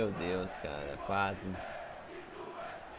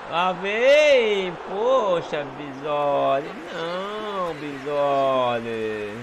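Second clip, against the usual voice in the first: excited groan; 6 dB louder, much slower than usual. Real, not acted. Near miss in match.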